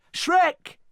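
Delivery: high, loud call; crying out. A narrator's reading. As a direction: call after the leaving boat. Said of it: "Fiona cried".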